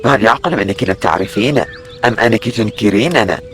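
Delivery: low proud legionary, baffled; proud Roman soldier. Acted. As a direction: puff up with Roman pride. Narrator voices proud Roman soldiers.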